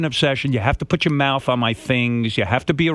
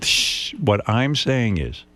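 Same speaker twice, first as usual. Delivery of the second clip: slow; 5 semitones lower, much slower than usual. Real, not acted. [name] calming himself after laughing.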